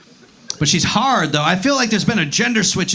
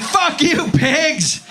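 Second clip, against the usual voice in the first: mock yell; 7 semitones higher, much slower than usual. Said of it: "She was like".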